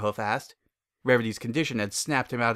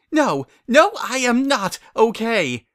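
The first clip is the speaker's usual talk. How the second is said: pained wail; 11 semitones higher, 8 dB louder, slower than usual. Acted. Rarity in pain.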